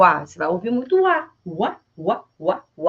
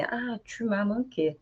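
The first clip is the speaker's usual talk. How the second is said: slow soft tu m'as manqué; tender; 8 dB quieter, slower than usual. Shown as a demonstration. Teacher showing how to greet a loved one. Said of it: "você fala assim".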